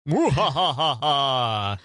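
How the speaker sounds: slow, drawn-out ghostly laugh; a hammed-up haunted-house cackle; spooky laugh. Shown as a demonstration. Showing his spooky repertoire.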